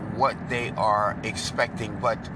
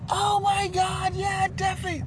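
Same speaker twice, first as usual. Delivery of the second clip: thrilled squeal. Retold or imitated. Barista Hannah thrilled by the gift of a book, high squealing.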